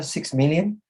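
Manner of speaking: usual speaking voice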